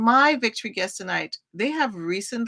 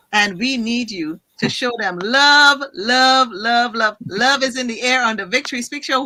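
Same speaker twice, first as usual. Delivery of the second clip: hype host; 3 semitones higher, 7 dB louder than usual. Acted. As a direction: pump up the audience. Hosts hype the audience.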